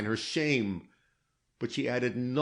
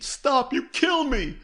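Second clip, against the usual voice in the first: high, louder anguished pleading; frantic terrified begging; mortal anguish; 12 semitones higher, 6 dB louder than usual. A narrator's reading. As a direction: beg him to stop, near breaking. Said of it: "This was mortal anguish".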